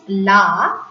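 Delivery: "la" as an open "lah"; pronunciation demo. Shown as a demonstration. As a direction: model French "la" with a long a. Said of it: "which is pronounced as".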